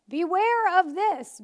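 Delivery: slow, loud, high warning; emphatic warning. Acted. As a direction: hammer the warning home. Preaching scripture warnings.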